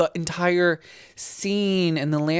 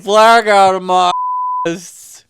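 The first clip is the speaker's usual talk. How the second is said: loud, higher mock quote, laughing; loud teasing repeat; 4 semitones higher, 9 dB louder than usual. Real, not acted. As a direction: shout her slip back at her. Mocking friend's slip, laughing.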